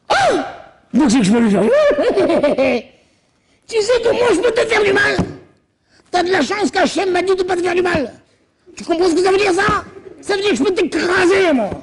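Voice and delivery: high, laughing menace; high mocking threat; gloating Laban. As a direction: gloat over your power to hurt. Laban misreading God's warning.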